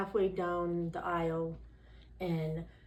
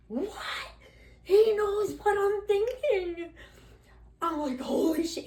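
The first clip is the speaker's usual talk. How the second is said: higher, louder, stunned and slowed; stunned disbelief; 13 semitones higher, 6 dB louder than usual. Retold or imitated. Retelling the priest knowing her thoughts, stunned and awed. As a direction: gasp it out like your mind was just read.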